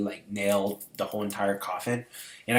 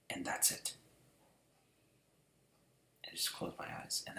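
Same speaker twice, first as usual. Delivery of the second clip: whispered; 4 semitones lower, 16 dB quieter than usual. Real, not acted. Accepting death in dream.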